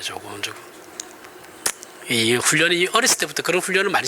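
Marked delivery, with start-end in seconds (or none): 0.0-0.6 조곤조곤 said much softer, low and calm; soft and gentle. Shown as a demonstration. Preacher shows the congregation how to speak gently, softly. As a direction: murmur 조곤조곤 the way you should speak. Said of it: "조곤조곤 해야 됩니다".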